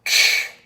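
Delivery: K as a bare breathy puff, no voice; whispered K. Shown as a demonstration. Teacher modeling K as a bare puff of air. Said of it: "Your turn".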